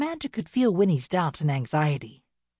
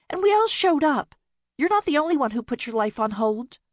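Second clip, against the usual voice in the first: much higher and louder, fed up; exasperated; 9 semitones higher, 4 dB louder than usual. Acted. Bea keeps scolding Winnie, high and exasperated. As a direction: let it all out at her.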